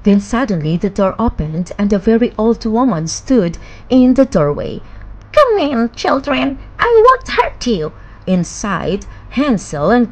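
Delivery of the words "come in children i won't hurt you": put-on high, coaxing old witch voice; sweet witch. A narrator's reading. Old witch inviting the children inside, high and honeyed. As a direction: lure them in with false sweetness.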